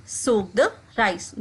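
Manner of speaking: slow, careful drill phrase; slowed classroom demo; slow model repetition. Shown as a demonstration. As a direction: say it slowly for learners to copy. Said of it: "इसको हम English में कहेंगे".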